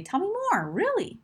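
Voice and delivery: high, rising, intrigued question; curious. Shown as a demonstration. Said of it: "communicate curiosity. You can say".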